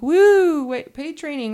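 a naive new hire's thrill; mock excitement. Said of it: "and you think".

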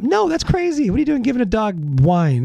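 high, incredulous outburst; shocked disbelief. Real, not acted. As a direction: react like it is unbelievable. Reacting to wine-giving dog story.

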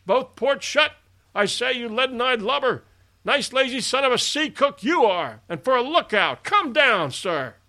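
high, loud, scolding shout; no very conciliating tone. A narrator's reading. Captain berating the sleepy lookout aloft, angry shout. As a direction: chew out a lazy sailor from the deck. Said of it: "in no very conciliating tone".

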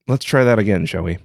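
ordinary delivery, nothing marked

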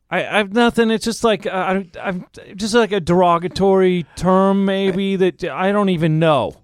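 high, stammering excuse; mock flustered backtracking. Actor explaining joke to producer, flustered. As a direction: squirm out of it in retold panic.

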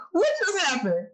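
can't believe what she just heard; shocked what. Real, not acted. Hearing that Santa got covid, a high shocked cry.

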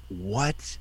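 small, soft retold reaction; hushed, deflated question; stunned disbelief. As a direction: say it under your breath, taken aback. Stunned reply to being replaced.

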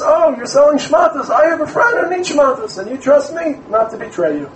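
lightly voiced eager merchant pitching a deal; friendly businessman.

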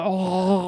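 loud, open-mouthed "ohh"; jaw-unhinging "oh". Acted. Chad showing how he'd swallow the bag, loud gaping oh. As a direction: gape wide and let out a loud oh. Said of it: "unhinge my jaw and go".

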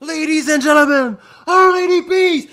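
high, loud announcer call; ring announcer. Pretend.

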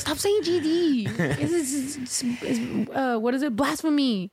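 prim scold stretching the words; lightly hammed-up scold; preachy younger self. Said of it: "Like, I was that person".

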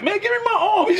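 very high, laughing imitation of protest; mock plea. Teammate mimicking a player whose wrist is grabbed, high laughing plea. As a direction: plead way up high while cracking up.

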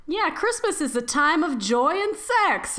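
high, bright joking proclamation; mock cheerful. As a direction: sell it like a jolly slogan. Joking reply, high far voice.

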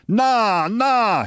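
very high, excitable henchman; high-voiced protest without real volume; mock shouting. A narrator's reading. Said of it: "he shouted".

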